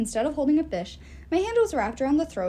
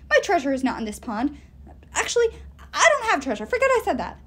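much higher, louder squeaky fish voice; frantic captured fish; 4 semitones higher, 5 dB louder than usual. Acted. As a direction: babble like a caught fish trying to bluff. Captured dwarf-fish pleading.

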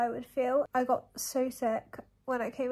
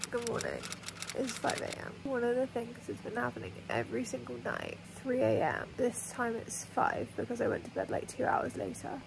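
whispering; 7 dB quieter than usual. Real, not acted. Woken at 5am, whispering.

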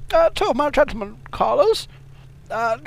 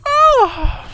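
high, loud moaning cry; falling high-pitched moan; loud groan; 10 dB louder than usual. Pretend. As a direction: let out a wailing 'oh'. Moans after passing wind.

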